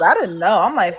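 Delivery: ordinary talk, nothing marked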